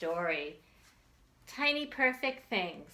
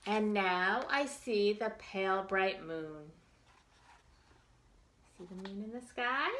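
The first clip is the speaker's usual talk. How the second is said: put-on reading to children; 3 semitones higher than usual. Teacher reads picture book to children.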